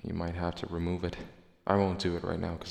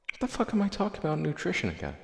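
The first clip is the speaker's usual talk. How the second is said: exasperated outburst; 10 semitones higher, faster than usual. Real, not acted. Catches himself rambling.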